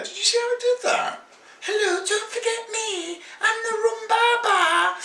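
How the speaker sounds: high, cartoonish voice of a cheeky bottle; talking brandy bottle. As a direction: squeak up high as the forgotten brandy calling out.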